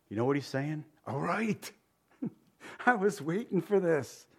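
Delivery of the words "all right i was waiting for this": a gentle, pleased answer with a chuckle; voicing the Lord. Preacher voicing God answering the sinner, lightly amused. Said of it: "That's what he says".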